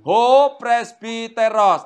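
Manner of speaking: deliberate teacher's reading of a Greek sentence; slow model reading. Shown as a demonstration. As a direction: read it out carefully for the class to copy.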